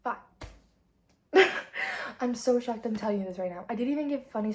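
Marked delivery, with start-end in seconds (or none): 1.3-2.2 loud burst of laughter. Real, not acted. Laughs at own rating.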